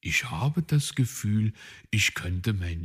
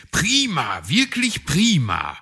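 cheering; 9 semitones higher, 7 dB louder than usual. A narrator's reading. Cheering a good run.

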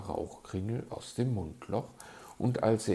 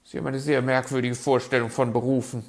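fast, loud, raised reproach; indignant magpie, hurried; 5 semitones higher, 10 dB louder, much faster than usual. A narrator's reading. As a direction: rattle it off, affronted. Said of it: "meinte sie entrüstet".

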